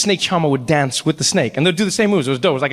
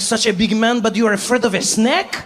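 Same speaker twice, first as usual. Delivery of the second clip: higher, mocking French-accented disbelief; 5 semitones higher than usual. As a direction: taunt as an incredulous Frenchman. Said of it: "the French guy".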